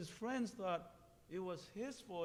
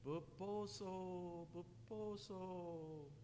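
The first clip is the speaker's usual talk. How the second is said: long, drawn-out hooting call; night bird call; 5 dB quieter, much slower than usual. A narrator's reading. Owl calling through the night, slow soft call. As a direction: stretch the name softly, like a far-off owl.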